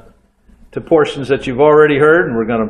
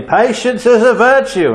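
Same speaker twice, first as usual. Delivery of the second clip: put-on higher, louder English rock singer accent; 9 semitones higher, 4 dB louder than usual. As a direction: announce it to the crowd in a real English accent. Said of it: "addressed the grumbling audience and said really loudly".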